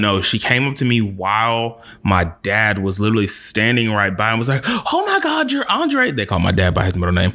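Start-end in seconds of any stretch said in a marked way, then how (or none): 4.7-6.1 put-on very high gushing older lady voice; high imitated older lady. Stranger at party gushing to him, very high mock voice. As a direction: squeal it like an excited stranger.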